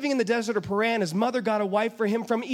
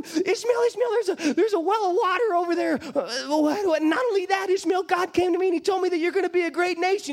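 much higher, urgent, fast joy; excited mother; 7 semitones higher than usual. Acted. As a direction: wake the boy with news. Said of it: "she's going".

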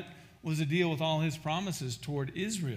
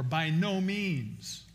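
slow emphasis; much slower than usual. Acted. Preacher stressing Paul's answer.

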